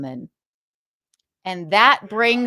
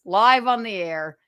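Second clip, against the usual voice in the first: ordinary delivery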